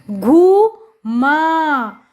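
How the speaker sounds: घू, then माँ, each held long; drawn-out घू … माँ for beginning readers; syllable by syllable. Shown as a demonstration. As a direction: sound out घू and माँ with a gap. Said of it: "जोड़कर पढ़ेंगे".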